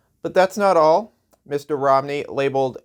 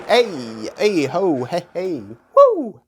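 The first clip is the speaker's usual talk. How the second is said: mock whoop; 4 semitones higher, slower than usual.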